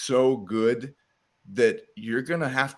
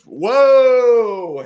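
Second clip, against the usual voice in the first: long, loud, high-pitched whoa; belted, drawn-out exclamation of astonishment; amazed whoa; 11 semitones higher, 13 dB louder than usual. Retold or imitated. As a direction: re-enact being blown away by a sudden chorus. Re-enacting surprise at song's chorus.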